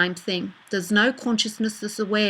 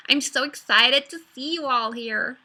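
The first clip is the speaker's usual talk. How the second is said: bubbly Californian valley voice, a bit higher; Californian accent; 4 semitones higher than usual. Pretend. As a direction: gush like an excited Californian. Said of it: "what about a Californian accent?".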